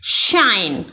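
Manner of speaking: शाइन said low and falling, with a clear sh; model word. Shown as a demonstration. Teacher models शाइन for students, clear sh. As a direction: set down शाइन for students to copy. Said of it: "इसे हम शाइन पढ़ेंगे".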